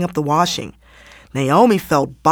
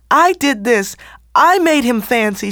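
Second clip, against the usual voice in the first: higher, tearful confession; sobbing; 6 semitones higher than usual. A narrator's reading. Naomi sobs to the elders that it is her fault. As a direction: own the blame through sobs. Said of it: "she sobbed".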